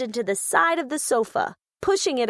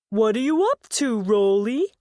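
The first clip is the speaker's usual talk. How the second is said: Muggo voice; 3 semitones lower, 3 dB louder than usual. Acted. Muggo greets Rolly.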